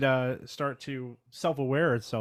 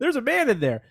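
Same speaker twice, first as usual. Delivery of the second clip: high squeaky repeat; 12 semitones higher, 3 dB louder than usual. Acted. Repeating the joke, higher.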